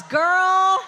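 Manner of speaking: ordinary delivery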